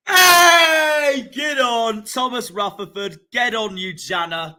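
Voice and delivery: loud high cheer; cheering shout. Real, not acted. Football fan shouting encouragement at a player. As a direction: yell him on.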